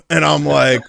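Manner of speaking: plain reading voice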